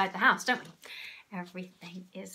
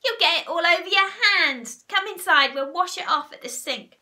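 high, loud scolding; urgent bossy character cry; warning cry; 9 semitones higher, 12 dB louder than usual. A narrator's reading. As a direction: order them loudly in a raised pitch. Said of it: "cried Missus Twit".

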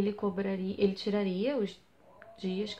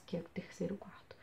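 whisper; 3 semitones lower, 9 dB quieter than usual. Real, not acted. Aside about the room.

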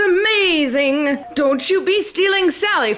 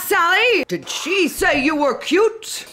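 ordinary talk

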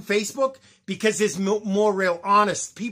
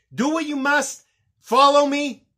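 urgent raised-voice appeal, higher pitched; rally listeners with a raised, insistent voice; 5 semitones higher than usual. Real, not acted. Vlogger urging people to follow him, raised voice. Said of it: "for raising my voice to, to be enthusiastic".

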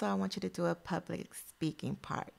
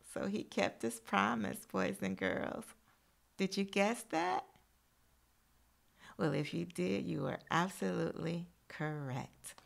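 ordinary delivery, nothing marked

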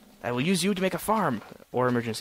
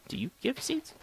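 high, soft wheedling ask; high coaxing voice; 12 semitones higher, 5 dB quieter than usual. Acted. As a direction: coax it sweetly.

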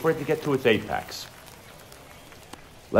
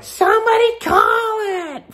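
very high, slow shrieking stands parent; mock parent yell; 3 dB louder, much slower than usual. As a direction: imitate a parent shouting from the bleachers. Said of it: "you hear somebody yell".

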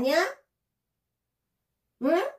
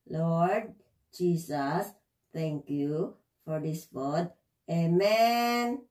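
prayer; 6 semitones lower, much slower than usual. Acted. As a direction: pray it slowly and gently. Prays over dog's food.

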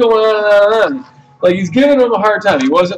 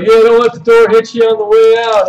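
raised, forceful imitation of the rude customer; mock hostile retort; 5 semitones higher, 4 dB louder than usual. Veteran's retort as the customer leaves, mock hostile. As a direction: throw his parting jab back, higher and insistent.

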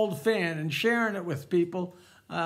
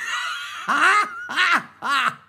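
loud, higher laugh at own joke; 5 semitones higher, 6 dB louder than usual. Real, not acted. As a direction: burst out laughing after the punchline.

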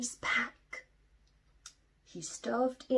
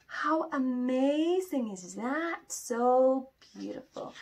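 higher, loud, slow gasping awe; amazed wonder; 9 semitones higher, 4 dB louder, slower than usual. Shown as a demonstration. Teacher shows the class the beach picture, awed and loud. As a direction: marvel at it with the children. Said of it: "teacher showing wonder to kids".

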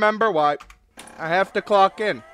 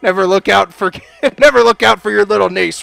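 loud scolding through laughter; loud laughing telling-off; 9 dB louder, faster than usual. Acted. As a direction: scold while cracking up.

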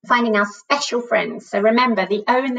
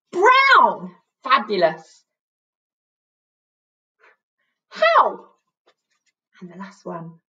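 excited; 9 semitones higher, slower than usual. Shown as a demonstration. Excited teacher revealing words.

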